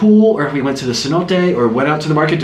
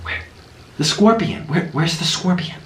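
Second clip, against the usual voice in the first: ordinary delivery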